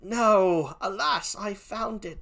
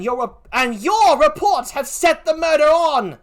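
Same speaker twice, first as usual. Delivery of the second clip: loud, high accusation; wife blames her husband for the murder; accusing; 7 semitones higher, 10 dB louder than usual. Acted. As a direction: throw the blame at him. Emilia accuses Iago.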